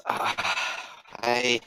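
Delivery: breathy, voiceless sigh trailing into a quiet word; resigned sigh. Acted. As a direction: sigh it out as he gives in. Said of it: "He kind of sighs and goes".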